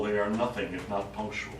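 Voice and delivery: ordinary delivery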